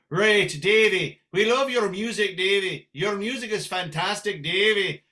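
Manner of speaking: lilting Irish brogue; Irish or Scottish brogue. Acted. Fans praising Davey's music, in an Irish brogue. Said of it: "in an Irish brogue or a Scottish brogue".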